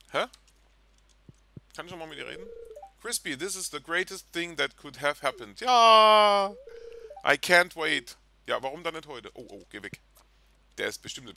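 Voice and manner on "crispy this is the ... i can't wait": loud, high overjoyed cry on ja; hammed-up delight; thrilled fiancée. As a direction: shout the joy out. Reading spouse NPC dialogue.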